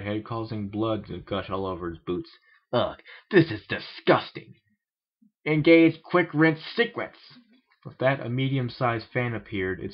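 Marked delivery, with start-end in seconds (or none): 2.7-7.2 disgusted alien. Acted.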